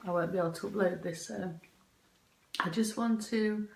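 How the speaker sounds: usual speaking voice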